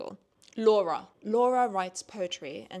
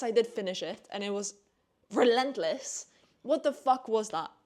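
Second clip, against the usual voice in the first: ordinary talk, nothing marked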